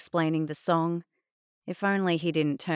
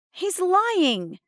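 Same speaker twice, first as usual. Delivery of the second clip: high, loud accusation; indignant protest; 14 semitones higher, 6 dB louder than usual. A narrator's reading. Girl accusing Adnan before Mum, indignant. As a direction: burst out in outrage.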